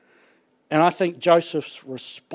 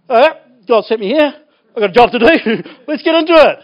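bright, louder, eager take; upbeat declaration; 4 semitones higher, 6 dB louder, faster than usual. Retold or imitated. To a congregation, acting a keen worker, louder and upbeat. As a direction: announce it like you're ready to work. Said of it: "Or you go".

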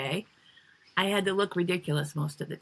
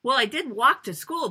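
normal talking voice

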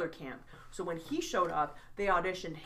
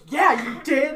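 loud, higher, pointed retort; mock indignant; 7 semitones higher, 12 dB louder, slower than usual. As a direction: land the accusation with a grin. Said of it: "And I was like".

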